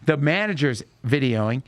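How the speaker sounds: ordinary delivery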